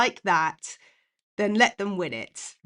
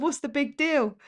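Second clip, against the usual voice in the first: mum's voice; 6 semitones higher than usual. Retold or imitated. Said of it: "She said".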